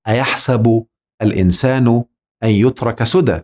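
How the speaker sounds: slow, measured Quranic recitation. Shown as a demonstration. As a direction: recite the verse slowly and carefully. Recites a verse slowly. (تلاوة بطيئة)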